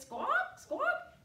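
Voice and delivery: very high, loud parrot squawks. Pretend. Teacher to the children, showing a parrot squawk. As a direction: screech it out like a parrot.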